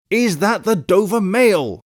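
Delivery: slow, high called question; insistent repeated call. A narrator's reading. Rider pressing the guard for an answer, slow insistent call. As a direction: spell it out slowly, calling high.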